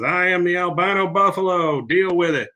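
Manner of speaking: hammed-up bold announcement; defiant proclamation. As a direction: declare it grandly, imitating a defiant character. Retold character makes defiant grand proclamation.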